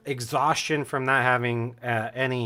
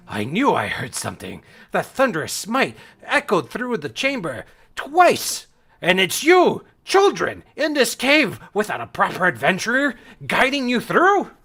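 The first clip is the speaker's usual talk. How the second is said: loud, high, booming indignation; higher and louder, incredulous at the end; stern battle master; 7 semitones higher, 6 dB louder than usual. Acted. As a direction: scold the children as a commanding battle master.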